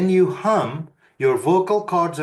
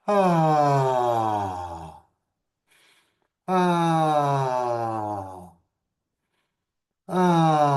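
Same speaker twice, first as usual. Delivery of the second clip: ah sigh; much slower than usual. Shown as a demonstration. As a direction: open sighing ah. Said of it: "You can do an ah sigh".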